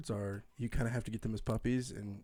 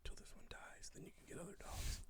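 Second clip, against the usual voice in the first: whispered rule; 2 semitones lower, 16 dB quieter than usual. Retold or imitated. Quoting the shelter rule.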